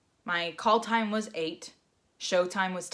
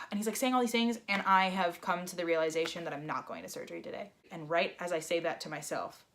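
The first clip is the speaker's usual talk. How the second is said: ordinary talk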